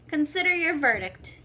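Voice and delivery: usual speaking voice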